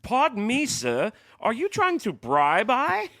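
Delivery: very high, slow, hammed-up posh voice; mock a stuffy old-world gentleman aghast at a bribe; pompous doorman. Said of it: "humiliates me in front of this huge crowd".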